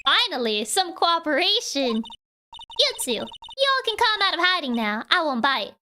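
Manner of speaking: Southern drawl for a grumpy photographer; Southern accent. Acted. Photographer scolding the kids about her film, in a Southern accent. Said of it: "see pull it off correctly".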